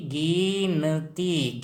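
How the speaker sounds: गिनती stretched syllable by syllable, गि-न-ती; slow teaching breakdown of गिनती; sounded out slowly. Shown as a demonstration.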